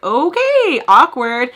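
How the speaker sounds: high, loud, drawn-out "okay"; awkward aside. Retold or imitated. Vlogger reacting to being caught laughing along, loudly awkward. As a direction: sing out the awkwardness, high then dropping.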